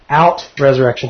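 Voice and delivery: ordinary talk, nothing marked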